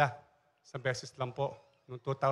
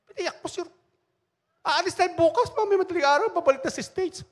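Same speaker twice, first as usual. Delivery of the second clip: much higher and louder, eager news; excited batchmates; 13 semitones higher, 6 dB louder than usual. Retold or imitated. Batchmates telling the pastor she flies home, excited. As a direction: blurt it out in a rush of excitement.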